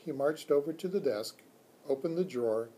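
plain reading voice

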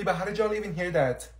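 ordinary talk

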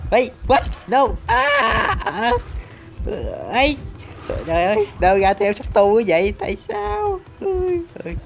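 squeaky falsetto disbelief; cartoonish high-pitched panic; high mock outrage. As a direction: shriek it in a silly high voice. Squeaky outrage at being chased.

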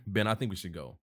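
ordinary delivery, nothing marked

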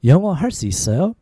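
flowing, syllables run together. Shown as a demonstration. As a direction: ask it at conversational speed. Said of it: "A little faster".

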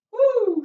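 loud, high woo; relieved whoop. Real, not acted. Trainer shaking out arms after a set, high whoop.

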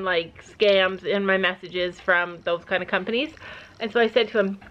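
usual speaking voice

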